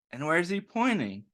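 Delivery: plain reading voice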